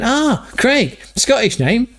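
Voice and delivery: high, put-on Scottish-Irish accent; [name]'s Celtic accent. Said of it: "The Scottish guy".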